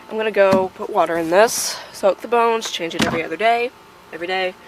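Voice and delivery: ordinary delivery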